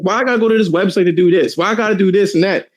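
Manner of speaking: high, quick whiny complaint; exasperated user. Retold or imitated. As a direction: mimic a fed-up PC gamer. Mocking frustrated PC users.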